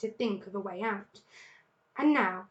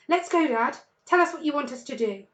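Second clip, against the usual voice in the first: louder, fast, keen; ready to go, pushing Dad; eager cubs; 4 semitones higher, 6 dB louder than usual. A narrator's reading.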